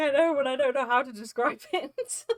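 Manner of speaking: high, loud retold protest breaking into laughter; laughing mock helplessness. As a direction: re-enact being helpless, cracking up at the end. Retells own helplessness, breaking into laughter.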